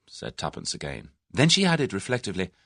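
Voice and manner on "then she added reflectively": ordinary delivery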